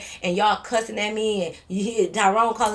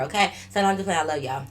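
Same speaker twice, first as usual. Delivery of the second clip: ordinary talk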